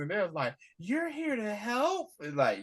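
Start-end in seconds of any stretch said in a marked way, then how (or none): 0.8-2.1 high, drawn-out mock disbelief; stretched-out, high-pitched astonished retort; incredulous question. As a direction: imitate someone who can hardly believe it. Kids' incredulous reply, retold.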